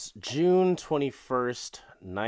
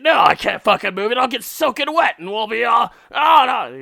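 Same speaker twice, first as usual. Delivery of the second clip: loud, high mock shouting; retold angry husband; 12 semitones higher, 11 dB louder, faster than usual. Husband tearing into his bride in the rain, mock yelling. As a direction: yell at her like a furious groom. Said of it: "just tearing into her".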